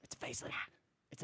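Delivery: whispered aside. Real, not acted. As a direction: hush it under the answer. Aside during another's answer.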